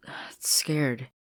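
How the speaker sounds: hesitant whispered admission; sheepish whisper. Acted. Sasha confessing to Marcy, whispered. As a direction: admit it under the breath. Sasha admitting her fear.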